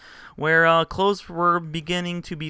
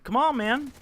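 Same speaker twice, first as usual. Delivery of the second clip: exasperated scolding; 9 semitones higher, 5 dB louder than usual. Retold or imitated. Scolding noisy girl in retold scene.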